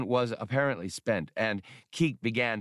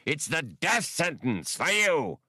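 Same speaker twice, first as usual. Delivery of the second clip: higher, menacing promise of doom; vowing threat; 7 semitones higher than usual. A narrator's reading. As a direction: threaten him with death. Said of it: "the Brigian vowed".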